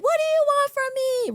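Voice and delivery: very high, loud mock scream at the sky; Scary Movie girl screaming. Imitating the Scary Movie girl, very high mock scream. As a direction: shriek it like the horror movie victim. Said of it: "the girl was in the middle of the street and she was like".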